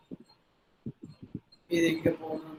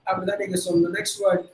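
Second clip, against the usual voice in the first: ordinary talk, nothing marked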